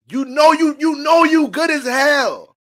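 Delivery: loud, high, slow hype-up; hype shout. Real, not acted. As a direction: pump them up, hollering. Hyping the listener up loudly.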